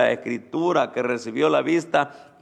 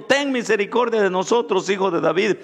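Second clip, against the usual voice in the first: high, raised plea for mercy; crying out; 7 semitones higher, 4 dB louder than usual. Acted. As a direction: call out loudly like the two blind men. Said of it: "dando voces y diciendo".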